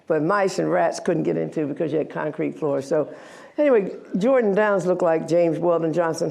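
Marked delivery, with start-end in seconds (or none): none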